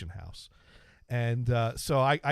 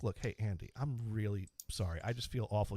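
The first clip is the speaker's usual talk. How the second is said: low, subdued apology; retold apology; 6 semitones lower, 9 dB quieter than usual. Telling how he approached a wronged classmate to apologize, subdued. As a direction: softly own up, as if retelling it.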